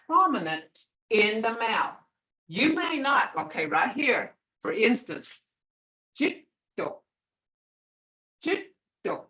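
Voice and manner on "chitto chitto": chitto with a held tongue stop; held consonant. Shown as a demonstration. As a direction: press the t against the palate. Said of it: "You don't hear that first D".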